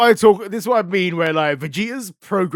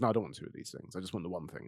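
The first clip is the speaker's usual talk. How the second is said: put-on low, soft sulky refusal like a fussy toddler; toddler energy; 8 semitones lower, 14 dB quieter, much faster than usual. A fan mimicking a picky god refusing a second gift, low and sulky. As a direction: mumble it like a stubborn little kid. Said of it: "It's toddler energy".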